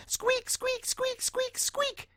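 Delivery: tiny, extremely high mouse squeaks; rapid falsetto squeaking; squeaking mice. Acted. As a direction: squeak like a family of excited mice. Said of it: "exclaimed Mister and Missus Mouse".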